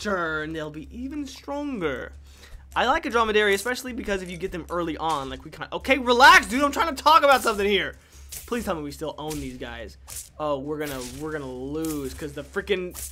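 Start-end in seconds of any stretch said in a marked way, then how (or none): 5.7-8.0 loud, higher, hurried protest; mock exasperation. Streamer interrupted mid-explanation by the game, loudly exasperated.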